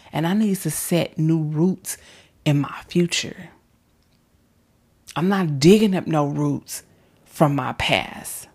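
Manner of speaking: ordinary talk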